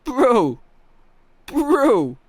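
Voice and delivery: high, loud "bro" swooping down; Chronicle impression. Streamer showing how teammate says bro, high loud mimicry. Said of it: "That's how he says bro".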